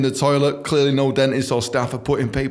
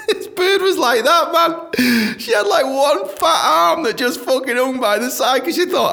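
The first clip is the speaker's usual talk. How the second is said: high, loud, helpless laughing delivery; laughing hard; 5 dB louder, slower than usual. Real, not acted. Comic describing a colleague's odd arms to listeners, cracking up. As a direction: tell it through real squealing laughter.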